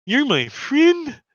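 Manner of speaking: very high, drawn-out creature voice; loud little squeal of a question and cheer; Lulu character voice. Acted. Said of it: "and she's like".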